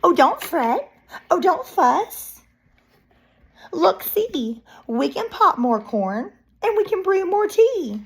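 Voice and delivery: high-pitched little mouse voice; squeaky mouse. A narrator's reading. Mouse consoling the blubbering bear, high squeak. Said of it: "that mouse squeaks".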